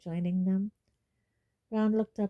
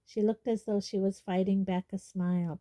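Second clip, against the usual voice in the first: ordinary delivery, nothing marked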